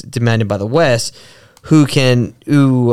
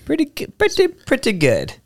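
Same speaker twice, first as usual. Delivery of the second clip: comic catchphrase; 14 semitones higher, 3 dB quieter than usual. Acted. Co-host riffing on "pretty good", high comic catchphrase voice. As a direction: squeeze it up high, playfully repeating.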